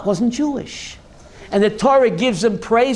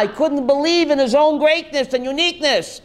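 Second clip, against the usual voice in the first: ordinary talk, nothing marked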